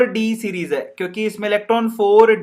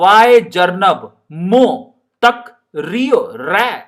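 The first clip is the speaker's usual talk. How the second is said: slow, drawn-out Rajasthani scolding; Rajasthani drawl; 3 dB louder, much slower than usual. Acted. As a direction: stretch it out like a Rajasthani teacher. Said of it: "Rajasthani भाषा". (धीमा राजस्थानी लहजा)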